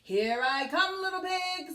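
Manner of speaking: a hint of a gloating wolf; lightly voiced wolf. Wolf taunting the pig at the fireplace, mock menacing. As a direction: menace the little pig, playfully. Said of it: "said the wolf".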